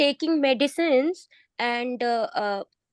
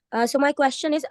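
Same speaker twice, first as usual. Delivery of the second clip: ordinary delivery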